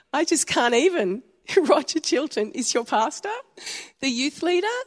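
high, amazed disbelief; can't believe the coincidence; stunned. Retold or imitated. Daughter learning her old youth leader is her parents' pastor, high and amazed. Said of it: "I was like".

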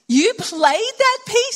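can't believe what you just heard; stunned, high-pitched retold surprise; staggered disbelief. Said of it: "I was just staggered".

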